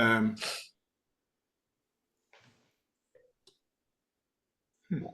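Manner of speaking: talking around something stuck in mouth. Real, not acted. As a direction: mouth partly blocked by something. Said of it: "I just got something stuck in my mouth there".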